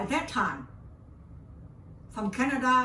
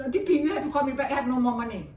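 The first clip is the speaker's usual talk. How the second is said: urgent rush; 5 semitones higher, 7 dB louder, much faster than usual. Retold or imitated. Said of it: "I said".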